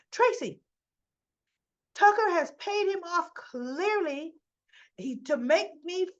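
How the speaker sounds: high-pitched, worked-up accusation; agitated insistence. Acted. Ashley insisting to Tracy that Tucker paid him, acted agitation. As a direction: insist urgently, acting worked up.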